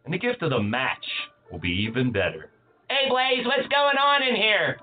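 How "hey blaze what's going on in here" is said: high nasal-free sidekick voice, pitched up; Radio Rex voice. Acted. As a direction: barge in as a pushy sidekick. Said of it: "it's Radio Rex".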